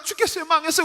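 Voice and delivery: quick high-pitched wail; mock doomed complaint; acted high lament. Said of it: "한탄하다가".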